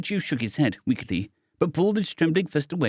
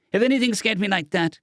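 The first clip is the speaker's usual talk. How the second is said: heated; 9 semitones higher than usual. A narrator's reading. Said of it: "Cadet started hotly".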